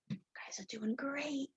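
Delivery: soft encouraging whisper. Real, not acted.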